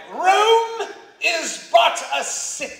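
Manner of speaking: high, slow, booming declamation; grand stage delivery. Retold or imitated. Actor opening his big scene dialogue, loud and grandly slow. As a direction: proclaim it like a Roman emperor on stage. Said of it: "which is something like".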